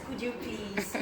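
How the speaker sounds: ordinary talk, nothing marked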